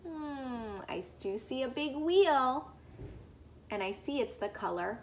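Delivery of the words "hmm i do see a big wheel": usual speaking voice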